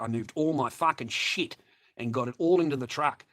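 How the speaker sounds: usual speaking voice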